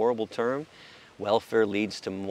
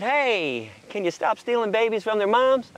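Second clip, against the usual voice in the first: high, louder polite request; mock activist ask; 10 semitones higher, 5 dB louder than usual. Voicing activists asking.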